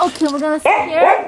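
high sing-song voice to a dog; pet talk. Real, not acted. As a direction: coo it to the puppy. Talking to her dog.

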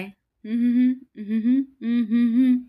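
loud, high run of hms; acted humming. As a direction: hum it out high and loud in short bursts. Wordless hums after a taunt.

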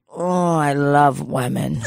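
slow, lightly voiced lecherous old man; mock Donald. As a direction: drag it out like dozing Donald.

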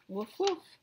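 high puppy yips; puppy woof. Acted. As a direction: bark like a small dog. Puppy barks in story.

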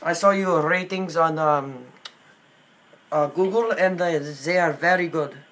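put-on hesitant Indian accent, full of fillers. Prank caller claiming homesickness for India, in Indian accent. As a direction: fumble politely in an Indian accent.

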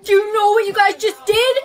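high, loud accusation; outraged. Acted. Girl confronts friends who marked her face, outraged.

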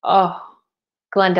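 normal talking voice

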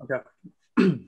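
usual speaking voice

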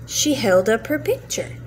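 ordinary delivery, nothing marked

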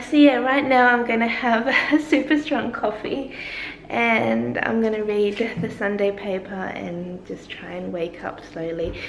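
ordinary delivery

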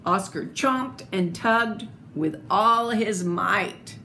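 usual speaking voice

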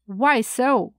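ordinary delivery

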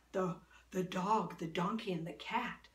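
usual speaking voice

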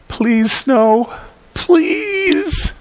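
put-on high, stretched little-boy begging; Calvin pleading. Calvin on his knees praying for snow, high pleading. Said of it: "He's offering prayer".

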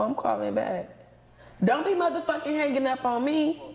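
usual speaking voice

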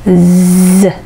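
Z voiced with the tongue pushed forward; 有声音のZ. Shown as a demonstration. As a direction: buzz the Z sound. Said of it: "Zは優声音ですので".

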